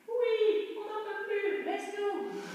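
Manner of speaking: high squeaky cartoon voice. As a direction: squeak it like a tired cartoon character. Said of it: "je fais des petites voix de dessin animé".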